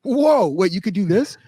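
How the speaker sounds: high, wide-eyed mock wonder; amazed surprise. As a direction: sound floored by the discovery. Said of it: "I was like".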